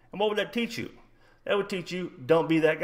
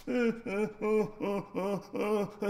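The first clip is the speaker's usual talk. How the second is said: high, breath-jerking fake sobs; mock sobbing; 8 semitones higher, 5 dB quieter than usual. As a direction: imitate a beaten kid blubbering. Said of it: "He's fucking crying tears".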